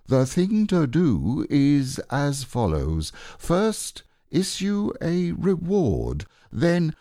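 self-important owl voice; pompous Owl. Acted. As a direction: lay out the plan like a know-it-all. Owl lecturing Pooh.

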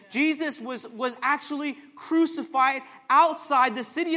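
loud, rousing sermon delivery; preacher building in punchy repeated phrases; full-force preaching. Real, not acted. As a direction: drive each phrase home to a congregation.

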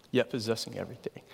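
a reverent whisper to end the list; hushed whisper. Real, not acted. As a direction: whisper it, fast and breathless. Quoted verse ends in whisper.

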